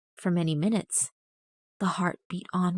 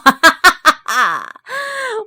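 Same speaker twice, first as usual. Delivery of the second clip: loud, high maniacal cackle; mad laugh; 10 semitones higher, 13 dB louder than usual. A narrator's reading. Murderer proud of his clean work, maniacal laugh. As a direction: burst into gleeful madman laughter. Gloating after hiding body.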